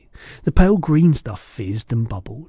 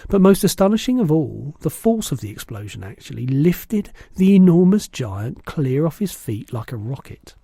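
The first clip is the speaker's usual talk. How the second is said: ordinary delivery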